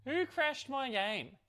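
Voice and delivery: high, drawn-out mock accusation; slow, high-pitched pretend outrage. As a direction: demand it slowly in playful indignation. Mock accusation after the crash.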